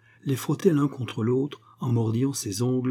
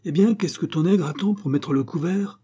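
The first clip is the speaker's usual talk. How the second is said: higher, irritated demand; angry; 6 semitones higher than usual. A narrator's reading. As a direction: snap the question with acted anger. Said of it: "Puis avec colère".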